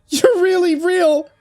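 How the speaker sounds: put-on high, tearful girl's voice; sobbing girl. A narrator's reading. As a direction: cry it out in relief. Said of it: "she sobbed".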